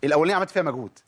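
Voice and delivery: ordinary talk, nothing marked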